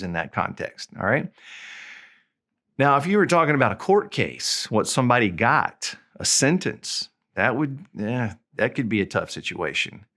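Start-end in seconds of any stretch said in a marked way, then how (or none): none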